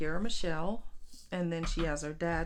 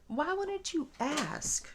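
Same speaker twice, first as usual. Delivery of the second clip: slow exasperation; 10 semitones higher, much slower than usual. Retold or imitated.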